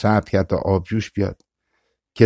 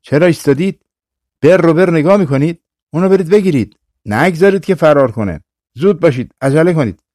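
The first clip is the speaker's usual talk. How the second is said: high, loud urgent commands; shouting orders; 6 semitones higher, 3 dB louder, faster than usual. Acted. Old ascetic shouting at his students to catch the badger. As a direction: yell at the frozen students to move. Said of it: "زاهد پیر فریاد کشید".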